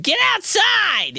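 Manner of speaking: high, slow mock shout of a mom; mom yelling. Mom yelling at the bored kid to get outside, high mock shout. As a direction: bark it like a fed-up mom. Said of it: "my mom was like".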